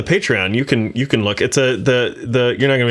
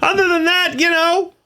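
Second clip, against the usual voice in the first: squeaky mock-cheery answer; high joking voice. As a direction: pitch it way up, chipper. Joking answer about how he is.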